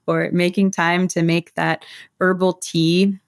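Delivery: usual speaking voice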